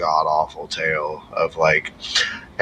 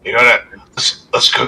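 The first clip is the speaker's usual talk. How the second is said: put-on lightly slurred drunk man, a bit higher; drunk Trovis; 8 semitones higher, 6 dB louder than usual. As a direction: play the tipsy guard inviting everyone along.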